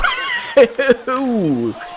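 long, high-pitched whooping laugh; elated high laugh. Real, not acted. Gamer celebrating a power-play goal, elated laugh. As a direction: burst out in shrieking laughter.

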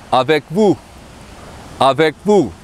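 avec vous, said ah-vek voo; French pronunciation. Shown as a demonstration. Teacher models formal avec vous, careful French. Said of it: "Or you in the formal".

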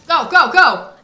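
very loud high go go go; urgent shout. Real, not acted. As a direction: hurry him out. Urging Sim to run.

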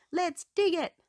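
high, loud keen puppy call; eager cheer. A narrator's reading. Rubble eager to start digging, high and loud. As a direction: fire up for the job. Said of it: "says Rubble".

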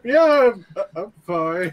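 high, loud, strained reply; hammed-up strained voice claiming to be okay; strained insistence. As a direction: jump up high as if barely coping. Wounded character insisting he is fine.

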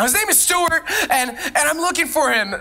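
high, urgent voice of a frantic mom; panicked mother. Retold or imitated. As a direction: plead as the worried mother searching. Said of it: "I'm just gonna go with Stuart".

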